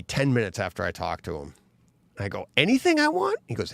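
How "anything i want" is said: high, incredulous "anything I want?"; disbelieving squeak of a question; surprised question. Retold or imitated. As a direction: ask it as if you can't believe your luck. Asking client, incredulous.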